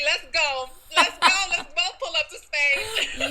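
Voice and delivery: high, loud rallying cry breaking into laughter; excited hype. Retold or imitated. Friend retelling how they'd both head to Spain, excited hype.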